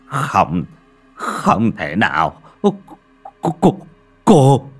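halting disbelief climbing into high alarm; stunned stammering. A narrator's reading. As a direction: choke on the word, then rise in dread. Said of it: "miệng lắp bắp".

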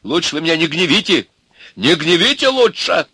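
high, loud angry warning; shouted threat. A narrator's reading. Ivan warning Milka off, shouting. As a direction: holler that you've had enough. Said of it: "закричал Иван".

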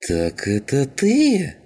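high, slow seething accusation. A narrator's reading. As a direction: draw the question out, voice climbing with spite. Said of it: "зашипел скинхед". (злобно, высоко и протяжно)

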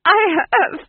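high, loud, broken giggle through the words; giggling. A narrator's reading. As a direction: manic duke tittering. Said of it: "The Duke giggled".

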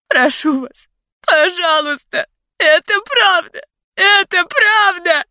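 choked cries building to high screams; tearful pleading. A narrator's reading. Heroine begging captors, tearful desperate screaming. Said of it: "Меня душили слёзы".

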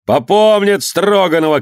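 high, drawn-out shouted warning; shouted threat. A narrator's reading. Ivan threatens the men amid the brawl, shouting. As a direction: cry out a slow threat. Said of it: "кричал Иван".

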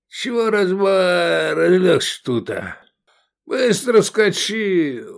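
put-on high, dragged-out drunk slur; drunken taunting. A narrator's reading. Drunk Shavkat mocks the man on the ground, drunken drawl. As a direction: jeer slowly like a sloppy drunk, pitched high. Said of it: "пьяный, слюнявый, мерзкий".

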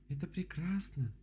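high, drawn-out delight; delighted objection. A narrator's reading. Bear contradicts the rabbit, delighted. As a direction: counter it high and slowly. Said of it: "возразила Мишка".

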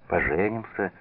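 ordinary delivery

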